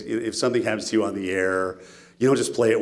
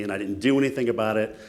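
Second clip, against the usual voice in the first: ordinary talk, nothing marked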